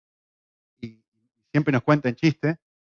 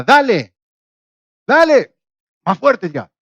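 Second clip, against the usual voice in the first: loud, high shouts urging the pull on; shouted encouragement; 6 semitones higher, 6 dB louder, slower than usual. Retold or imitated. Argentine worker on the rope shouting back his mishearing, loud high yells. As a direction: call out to push him harder.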